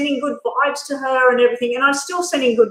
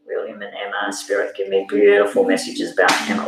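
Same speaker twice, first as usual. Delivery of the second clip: much lower, hushed calling on spirit; spirit invocation; 14 semitones lower than usual. Acted. Reader calling on spirit for William and Emma, low and solemn. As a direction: drop deep to summon the spirits.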